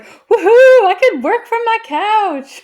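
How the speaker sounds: high, drawn-out woohoo of mock glee; excited cheer. Telling friends about the first week working from home, excited cheer. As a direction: cheer like it's the best news ever.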